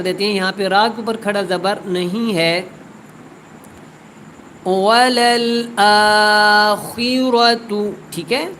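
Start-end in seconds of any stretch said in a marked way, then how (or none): 4.7-8.5 slow, melodic tilawat, each word drawn out; measured, sung-out Quranic recitation. Shown as a demonstration. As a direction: chant the verse slowly and melodically. Teacher recites verse again.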